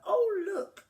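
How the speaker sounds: high, loud delighted cry; children spotting a new toy; thrilled exclamation; excited children. A narrator's reading. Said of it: "they said".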